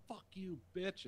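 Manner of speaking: high-pitched mock kid retort; defiant boy impression. Little boy answering the woman driver, high defiant impression. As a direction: imitate a boy snapping back, voice up.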